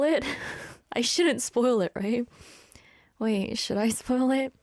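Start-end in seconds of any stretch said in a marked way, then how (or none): none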